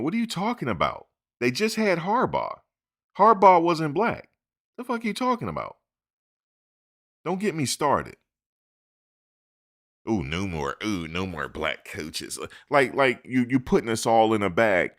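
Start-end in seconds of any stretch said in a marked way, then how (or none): 0.0-8.2 indignant outburst. Real, not acted. Angry rant at critics. 10.1-12.4 mocking whine. Mocking critics' whining.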